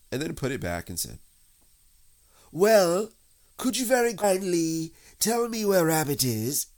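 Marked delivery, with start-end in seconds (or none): 2.5-6.7 high, loud, careful request; storybook bear voice; politely asking bear. A narrator's reading. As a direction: enquire very courteously into a hole. Pooh asks into the hole.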